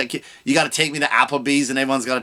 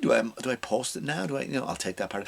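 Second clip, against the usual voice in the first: mock hushed aside; 3 semitones lower, 10 dB quieter than usual. Aside as [name] editing.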